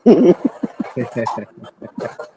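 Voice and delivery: quiet amused laughing; soft chuckle. Real, not acted. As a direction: chuckle softly to yourself. Amused reaction to being told of blows.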